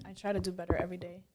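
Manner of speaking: soft whispered confession. Real, not acted. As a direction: whisper it, almost to yourself. Drops to a whisper.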